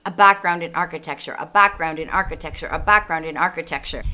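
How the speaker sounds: quick drill, a background in architecture over and over; speedy practice repetition. Shown as a demonstration. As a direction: rattle it off like practice. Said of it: "just repeat that".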